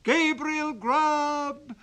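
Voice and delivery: very high, loud, drawn-out ghostly chant; wild chorus of voices. Acted. Goblin chorus calling the sexton's name, high and drawn out. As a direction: wail his name like a goblin choir. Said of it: "exclaimed the wild voices".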